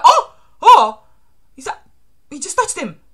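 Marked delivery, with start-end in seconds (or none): 0.0-1.0 two loud, high gasped "oh"s; startled cries. Acted. Reader seeing the sudden grab, loud startled cries. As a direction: cry out sharply in shock. Acting reaction to sudden attack.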